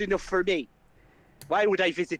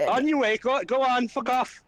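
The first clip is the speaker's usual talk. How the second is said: dismissive shout; 7 semitones higher than usual. Acted. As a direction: shoo them away rudely. Flynn dismisses them.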